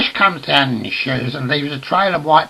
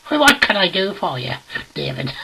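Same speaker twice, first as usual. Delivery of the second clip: comic phone voice; 7 semitones higher than usual. Acted.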